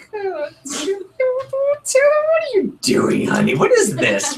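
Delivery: high, incredulous mock voice; skeptical customer. Trainer voicing a customer's skeptical inner thoughts, high and doubting. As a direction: play the baffled relative. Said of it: "in their mind they're going".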